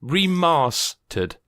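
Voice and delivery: "remastered" stretched out slowly with a long ah; drawn-out pronunciation. Shown as a demonstration. Host presenting the title pun, drawn out. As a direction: draw out the middle of "remastered". Says the disputed pun deliberately.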